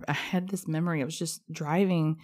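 ordinary talk

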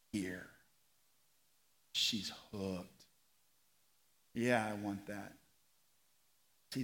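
near-whispered sermon delivery; hushed preaching. Acted. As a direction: lean in and speak almost to one person.

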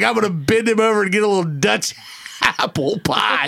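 mock lewd quote, laughing; laughing through quote. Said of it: "He's like".

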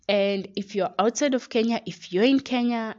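usual speaking voice

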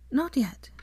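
put-on young woman voice. A narrator's reading. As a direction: tease him with a smile. Female character voiced.

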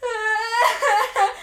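loud shrill laughing scream of fright; startled yelp; high scream. Retold or imitated. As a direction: let out a sudden scared shriek. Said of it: "And I'm like".

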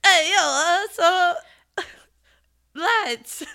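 loud, high, drawn-out New Jersey Italian accent; Italian-American holler cracking into laughter; East Coast Italian-American. Shown as a demonstration. Joke try at an East Anglian accent, Italian-American. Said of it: "East Coast Italian".

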